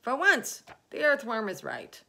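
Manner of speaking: loud, high astonished agreement; centipede's high, loud outburst; excited cry. Acted. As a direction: exclaim it as a sudden realisation. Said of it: "cried the centipede".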